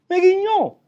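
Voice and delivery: "Me guiñó" squealed very high and loud; ecstatic squeal. Real, not acted. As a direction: shriek "Me guiñó" in sheer delight.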